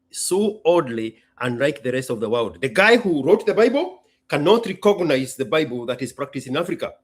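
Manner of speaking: ordinary talk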